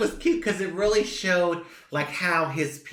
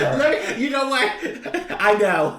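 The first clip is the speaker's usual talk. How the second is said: laughing; 6 semitones higher, 5 dB louder than usual. Real, not acted. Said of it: "taking it a little too far".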